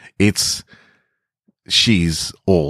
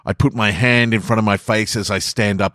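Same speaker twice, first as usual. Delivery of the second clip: ordinary delivery, nothing marked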